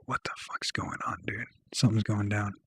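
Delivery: hushed, breathless whisper of dread; whispered alarm. Retold or imitated. Narrator sensing a setup on the corner, whispering in alarm. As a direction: whisper it as danger dawns. Realizing a deal is going wrong.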